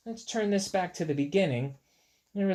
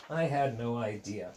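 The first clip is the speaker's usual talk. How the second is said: usual speaking voice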